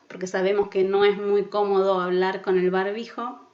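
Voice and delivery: usual speaking voice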